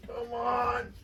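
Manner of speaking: strained, exasperated whine. Real, not acted. Struggling with part.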